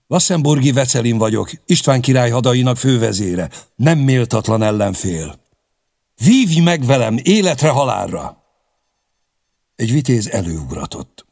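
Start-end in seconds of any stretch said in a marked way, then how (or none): 0.1-8.4 high, loud knightly challenge; announcing loudly. A narrator's reading. Vecelin challenges Koppány to single combat, loud proud announcement. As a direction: proclaim your name and call him to duel. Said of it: "fennhangon mondotta nevét".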